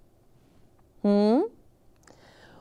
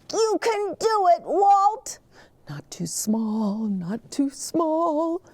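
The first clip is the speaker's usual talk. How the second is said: high, anxious little truck voice; Gus voice; 12 semitones higher than usual. A narrator's reading. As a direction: squeak it out nervously as little Gus. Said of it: "We're Gus and Walt".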